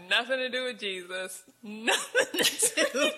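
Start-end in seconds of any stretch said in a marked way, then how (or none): 1.6-3.1 slow laughing echo; slow, giggling repeat. Real, not acted. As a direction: let the words dissolve into laughter.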